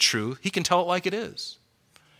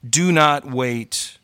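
slow, urgent plea; much slower than usual. Real, not acted. Repeated urgent appeal.

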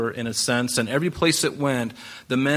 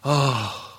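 long, relieved "oh" exhale; acted sigh of relief. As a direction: let out a mock sigh of relief. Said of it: "when you finally do that, you're just like".

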